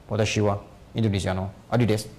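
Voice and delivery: low-pitched imitation of a Japanese speaker; put-on Japanese accent, pitched low. Shown as a demonstration. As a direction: introduce yourself like a Japanese speaker, voice dropped. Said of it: "Bicara apapun mirip".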